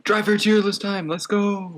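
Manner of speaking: high, excited hype call; hype announcement. Real, not acted. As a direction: pump it up like an announcer. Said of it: "I did not mean to read that verbatim".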